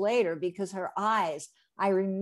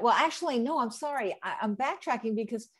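usual speaking voice

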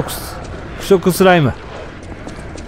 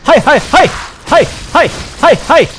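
excited hitting cries; 8 semitones higher than usual. Real, not acted. Rhythmic calls while hitting enemy.

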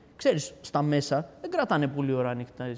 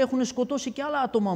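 retold alarm, pitched high; urgent warning; 9 semitones higher, 3 dB louder than usual. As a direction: relay the guard's warning in a raised pitch. Retold guard warning him. (αναπαράσταση επείγουσας προειδοποίησης)

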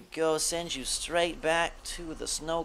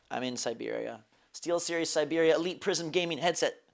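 usual speaking voice

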